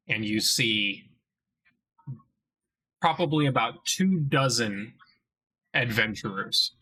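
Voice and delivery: slow, even scene-setting narration; game master narration. A narrator's reading. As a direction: paint the scene slowly for the players.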